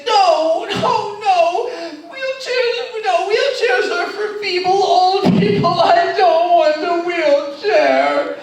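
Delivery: very high, loud wailing refusal, stretched out; mock sobbing mother. Mother refusing the wheelchair at the airport, high loud mock wail. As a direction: wail it like a proud old mother refusing help. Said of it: "And she was sobbing".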